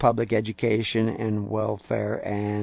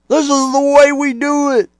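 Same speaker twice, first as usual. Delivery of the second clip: high, loud mocking voice; mock pastor claim; 9 dB louder than usual. Preacher mocking pastors who claim their way is right, high and loud. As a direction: ham up a smug pastor.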